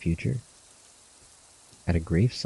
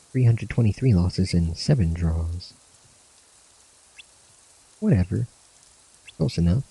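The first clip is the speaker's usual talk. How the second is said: lightly acted friends saying goodbye, a bit higher; voicing game characters; 3 semitones higher, 3 dB louder than usual. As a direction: give the farewell banter a light acted read, slightly raised pitch. Reads farewell scene dialogue.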